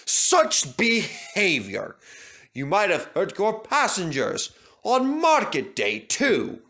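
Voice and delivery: wag a finger at the coaches; scolding. Acted. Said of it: "he scolded Agnes".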